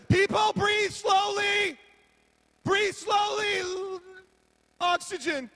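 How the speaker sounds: loud, high, slow yell to the crowd; mock panicked shout. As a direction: bark the order at everyone, drawn out.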